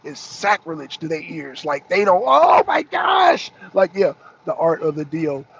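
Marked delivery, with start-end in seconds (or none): 2.3-3.5 high, loud, slow mock gasp of horror; mock shocked liberal. Mocking liberals who hear Trump was right, in a high shriek.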